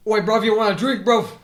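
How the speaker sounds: put-on loud, high, British-accented mascot yell; Kool-Aid Man. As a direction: bellow the offer in mock British. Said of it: "wall and then says".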